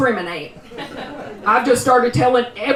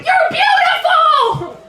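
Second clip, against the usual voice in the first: high, slow, shrieked compliment; shocked shout; 4 dB louder, much slower than usual. Retold or imitated. Comic retelling her shocked shriek back at a catcaller. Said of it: "I was like".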